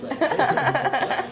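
slow, high vocal fry radio voice; hammed-up smooth DJ greeting. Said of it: "Ben, your radio voice".

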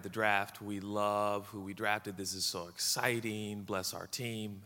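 low, soft, measured praying voice; quiet, reverent pastoral prayer. Real, not acted. Pastor praying over the team and congregation, prayerful.

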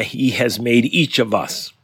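usual speaking voice

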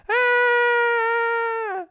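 long, very high-pitched squeal; mock yell. Buddy literally runs away from the guitar player, high mock yell. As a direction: imitate a fleeing yell on one high note. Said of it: "literally runs away yelling".

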